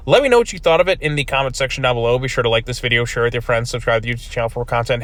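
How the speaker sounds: ordinary talk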